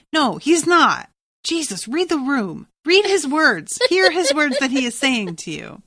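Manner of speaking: exasperated scolding. Acted. Viewer telling a character to read the room, exasperated.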